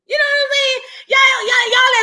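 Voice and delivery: high, loud call to the viewers; excited shout. Real, not acted. Host hollering at his viewers, a loud high call. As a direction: belt it out to grab everyone's attention.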